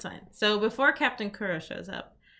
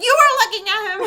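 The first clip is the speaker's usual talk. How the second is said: high, loud accusing yell through laughter; laughing shout; 12 semitones higher, 10 dB louder, much faster than usual. Retold or imitated. Zoro scolding Luffy for staring, a laughing shout. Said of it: "like, this really funny line".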